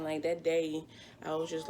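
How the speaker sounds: ordinary talk, nothing marked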